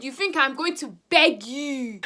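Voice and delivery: slow loud scornful question; mocking. Messaging the ex, slow mocking scorn. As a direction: sneer it out slowly.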